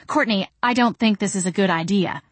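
ordinary delivery

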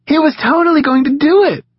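high, loud cry bursting with laughter; laughing cry. A narrator's reading. Marie to the boys, laughing at Knox, a high loud cry. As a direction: crow it out through the giggles. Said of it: "Marie cried".

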